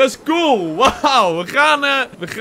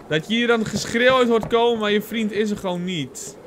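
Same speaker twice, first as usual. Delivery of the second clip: ordinary delivery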